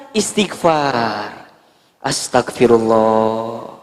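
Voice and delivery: istighfar pelan. Acted. Wife calming herself after discovery.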